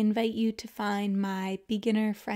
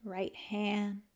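calm, unhurried yoga nidra guidance with long pauses; guided meditation; 3 dB quieter than usual. Acted. Teacher guiding listeners to let the body melt, calm guided meditation. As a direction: ease them into rest, leaving space after each phrase.

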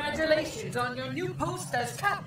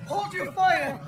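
urgent command; 6 semitones higher, 5 dB louder, slower than usual. Acted. Officer stops the attack.

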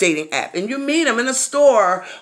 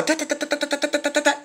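higher, soft rhythmic da da knocking sound; imitated knocking; 6 semitones higher, 6 dB quieter, faster than usual. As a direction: tap out the knock with your voice.

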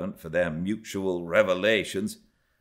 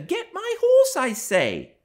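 put-on louder, high girlish command; insistent young girl; 6 dB louder, slower than usual. A narrator's reading. Young Cathy repeating her order to Hareton, high insistent voice.

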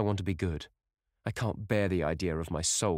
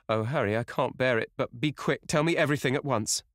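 higher, urgent, building in desperation; distraught plea; 5 semitones higher, 4 dB louder than usual. Acted. Dorian begs Harry to tell him everything, distraught. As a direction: beg him, unable to bear the wait.

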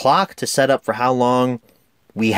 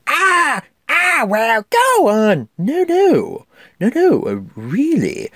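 Iago; 13 semitones higher, slower than usual. Retold or imitated. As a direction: play the fawning cartoon parrot, high and brash. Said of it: "another great vocal performance here".

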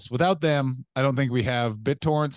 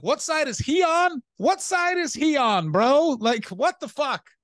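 high, loud incredulous mock yell; baffled outrage; 13 semitones higher, 4 dB louder, slower than usual. Comic describing his confusion at a Confederate flag, high and baffled. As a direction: stretch it out in disbelief. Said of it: "And I'm like".

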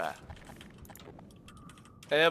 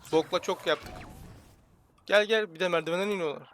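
ordinary talk

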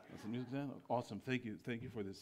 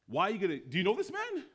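high, loud incredulous questioning; astonished, pitched-up outburst; bewildered commandant; 6 semitones higher, 5 dB louder, faster than usual. Acted. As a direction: break off and demand an explanation. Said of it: "bewildered, shocked, and awe".

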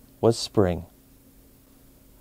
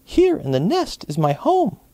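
much higher, slow birdlike voice; robin voice; 12 semitones higher than usual. A narrator's reading. Robin tells the bunny about its nest, high-voiced. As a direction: speak it as a tiny proud bird. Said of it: "sang the robin".

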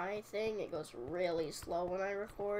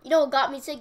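much louder, higher teasing question; loud burst; 5 semitones higher, 15 dB louder, faster than usual. Real, not acted. Gamer suddenly loud asking viewers what made her sick. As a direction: blurt it out to the viewers.

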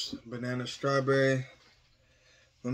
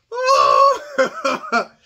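laughing pained shout; 10 dB louder than usual. Real, not acted. Laughing yell in pain.

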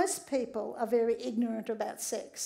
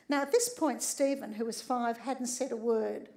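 ordinary delivery

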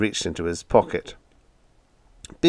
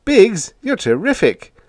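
yelled; 13 semitones higher, 6 dB louder than usual. A narrator's reading. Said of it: "I yelled".

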